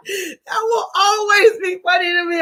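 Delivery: high voice full of real laughter; laughing hard. Real, not acted. Host cracked up by a viewer's comment, laughing. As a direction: talk while still helpless with laughter.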